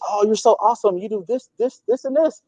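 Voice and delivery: gushing praise. Retold or imitated. Voices gushing praise from others.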